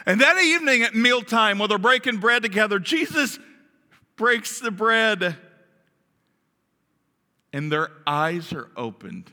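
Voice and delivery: ordinary talk, nothing marked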